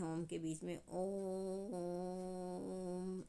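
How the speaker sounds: "ओम" drawn out very slowly, held three times longer; खींचकर बहुत लंबा "ओम"; stretched pluta om. Shown as a demonstration. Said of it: "और भी खींच के बोला जाता है". ("ओम" को धीरे-धीरे खींचकर बोलें)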